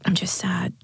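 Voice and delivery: sad, whispery voice. A narrator's reading. Jade finally answers her friend after a silence, sad and whispery. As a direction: half-whisper it, subdued.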